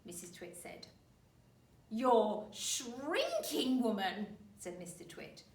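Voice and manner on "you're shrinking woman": put-on slow, loud, gloating old man; horrible grin. Husband taunts wife.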